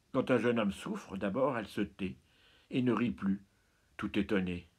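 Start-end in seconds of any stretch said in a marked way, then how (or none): none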